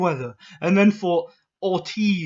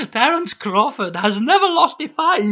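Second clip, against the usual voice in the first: mock the troll like a smug boy genius; troll as Dexter; 9 semitones higher, 5 dB louder than usual. Vlogger reading troll comments in a high mocking cartoon voice. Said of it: "how I imagine them in my mind".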